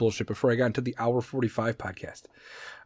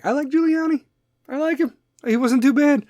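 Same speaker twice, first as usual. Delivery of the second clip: squeaky-high, defensive aside; high little voice of approval; high-pitched admission; 11 semitones higher than usual. Real, not acted. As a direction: pitch way up, as if sheepishly owning up. Playful defence of Giuliani.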